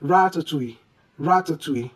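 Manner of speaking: ratatouille as ra-ta-TWEE, falling; correct ratatouille. Shown as a demonstration. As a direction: end ratatouille on French "too-ee", silent L. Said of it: "The correct way to pronounce this".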